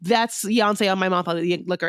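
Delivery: usual speaking voice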